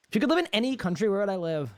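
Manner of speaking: quick rushed reread of the question; hurried recap of the viewer's question; fast reread. Real, not acted. Rereading the same chat question.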